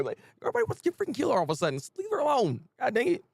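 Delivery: ordinary talk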